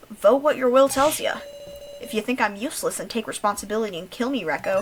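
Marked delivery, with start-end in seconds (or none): 0.1-1.4 loud, higher daring demand; forceful challenge. Acted. Kyutaro daring the others to vote against him, loud. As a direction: throw it down as a dare.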